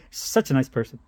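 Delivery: ordinary delivery, nothing marked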